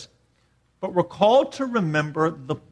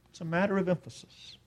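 much softer, quick aside; hushed repeat; 11 dB quieter, faster than usual. Real, not acted. Preacher letting the point on emphasis sink in, hushed. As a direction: drop it almost to a murmur.

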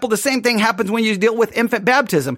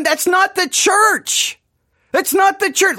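exasperated insistence; 8 semitones higher, slower than usual. Real, not acted. Exasperated rebuttal, repeated.

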